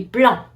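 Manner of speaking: French blanc ending on the nasal vowel; silent final C. Shown as a demonstration. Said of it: "The C at the end is silent".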